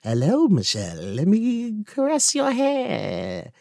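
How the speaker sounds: put-on slow, high creepy old lady crooning; creepy grandma voice. Friend mocks her grandma in a creepy high croon. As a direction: creep her out like a horror granny.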